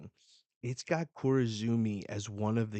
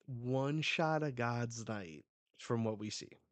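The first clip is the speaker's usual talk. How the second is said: ordinary talk, nothing marked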